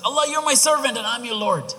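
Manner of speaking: high, loud, joyful outburst in one rush; overjoyed. Acted. Rescued traveler muddles his thanks to Allah, overjoyed. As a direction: cry it out, too happy to think. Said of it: "he's saying it out of happiness".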